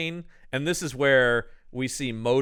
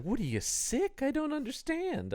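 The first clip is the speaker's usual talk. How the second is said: much higher, softer worried parent voice; parents' concern; 11 semitones higher, 9 dB quieter than usual. Retold or imitated. Parents question pale Jeremy, high and soft. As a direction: fuss over him lightly. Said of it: "And everybody's like".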